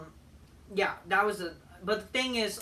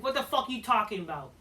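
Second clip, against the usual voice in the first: ordinary talk, nothing marked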